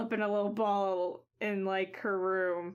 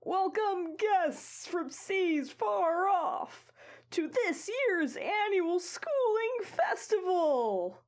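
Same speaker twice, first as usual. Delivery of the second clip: booming, high, slow grand welcome; pompous senator oratory; 10 semitones higher, slower than usual. Acted. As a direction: proclaim it to the whole crowd. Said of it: "announces with a booming voice".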